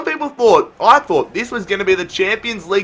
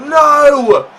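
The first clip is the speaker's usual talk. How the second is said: anguished no; 8 semitones higher, 7 dB louder than usual. Real, not acted. Chance missed.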